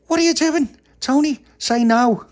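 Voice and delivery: high, loud telling-off; scolding shout. Acted. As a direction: call Tony out sharply. Pappy scolds Tony loudly.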